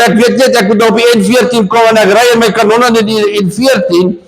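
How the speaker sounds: ordinary talk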